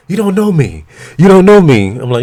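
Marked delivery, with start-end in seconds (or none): 0.1-0.8 high mocking imitation of her; whiny high protest. 1.2-2.0 high and loud mock protest. Mimicking her protest, louder.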